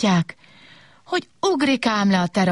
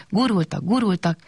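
plain reading voice

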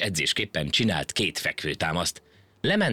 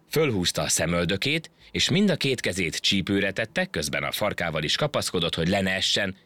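ordinary delivery

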